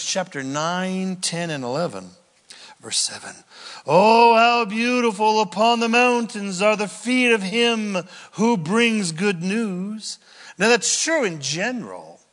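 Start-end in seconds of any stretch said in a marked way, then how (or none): 3.9-10.2 scripture reading. Acted. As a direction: let God's words ring out.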